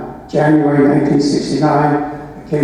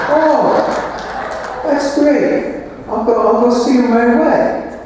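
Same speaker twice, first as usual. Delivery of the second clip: much higher, hammed-up eager joy; mock delight; 8 semitones higher, slower than usual. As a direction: overplay the happy rookie. Young coach greets news of assistant.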